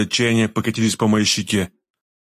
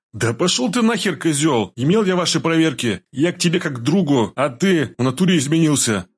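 higher-pitched, heated outburst; angry; 6 semitones higher than usual. A narrator's reading. Freed captive cursing his friend over the test, angry. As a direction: lash out at a friend who betrayed you. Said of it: "Со злостью ответил я Башня".

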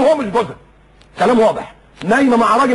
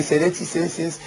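softly put-on TV presenter; delicate presenter; 3 semitones lower, 8 dB quieter than usual. Mimicking a woman presenter greeting viewers, soft and delicate. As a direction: lightly mimic a dainty announcer, softer. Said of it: "تتكلم كده برقه".

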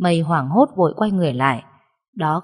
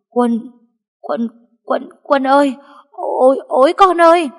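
terrified cry for her son, much higher and louder; panicked stammering call; 8 semitones higher, 6 dB louder than usual. Acted. Grandmother sees the body and calls her son in panic. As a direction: stammer out his name in shock. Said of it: "miệng ú ớ".

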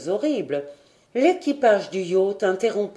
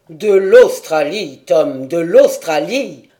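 loud, slow, insistent cry; emphatic chorus; 3 semitones lower, 9 dB louder, slower than usual. A narrator's reading. Companions answering the sailor as one, emphatic. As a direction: hammer it out forcefully. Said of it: "répondirent d'une seule voix".

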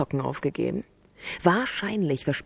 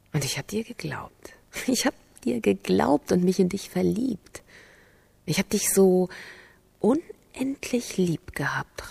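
softer, slowing whisper of betrayal; heartbroken whisper; 2 semitones higher, 4 dB quieter than usual. Acted. Heartbroken confession.

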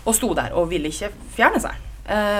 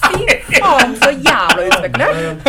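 1 laughing outrage; 6 semitones higher, 7 dB louder, much slower than usual. Real, not acted. Laughing retelling of the catcaller.

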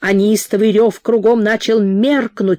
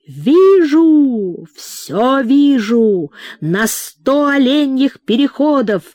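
piercing shout; 5 semitones higher, slower than usual. A narrator's reading. Shaman crying out his vision to the tent. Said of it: "Громко, пронзительно крикнул шаман".

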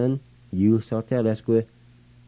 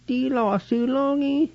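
high squeaky voice; slower than usual. Shown as a demonstration. Repeating the phrase back in a high voice. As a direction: pitch jumped way up, same loudness.

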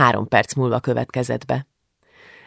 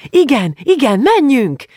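put-on high, loud girlish cheer; jubilant cheering; 11 semitones higher, 5 dB louder than usual. A narrator's reading. Julcsi agrees to go out celebrating, jubilant cheer. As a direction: whoop with joy at the idea. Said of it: "mire Julcsi újjongott".

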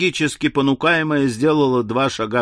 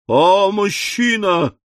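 high, drawn-out cry of alarm; horrified wail; 7 semitones higher, 3 dB louder, slower than usual. A narrator's reading. Miss Smithers finds a man behind the door, wailing. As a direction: wail it slowly in hysterical fright. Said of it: "возопила мисс Смитерс".

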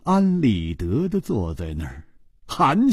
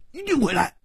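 急着保证; 10 semitones higher, faster than usual. Acted. 胡铁花急着保证.

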